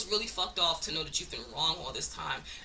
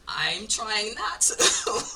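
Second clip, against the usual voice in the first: laughing through it; 6 dB louder, much slower than usual. Real, not acted. Admitting she wants to cuss people out.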